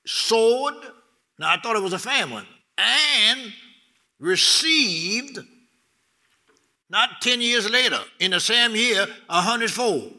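high, loud preaching climax; preaching at full force. Acted. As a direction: proclaim it to the church at full force. Preaching with raised voice.

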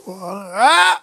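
"ah" rising sharply at the end; sudden exclamation. Real, not acted. Preacher caught up in worship, rising outcry. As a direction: cry out with a quick upward swoop.

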